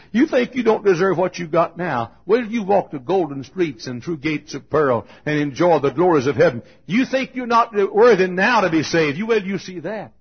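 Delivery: loud, exhorting pulpit voice; forceful preaching. Real, not acted. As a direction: press it home to the congregation. Exhorts the congregation.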